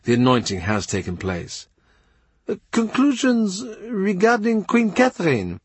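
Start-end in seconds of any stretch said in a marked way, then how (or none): 2.5-5.6 high, careful foreign envoy voice; Chapuis voice. A narrator's reading. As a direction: Chapuis speaking with courtly hesitation. Ambassador voice again.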